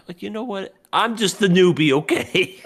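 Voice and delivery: high, loud mock complaint; mock indignant. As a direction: play the offended newbie.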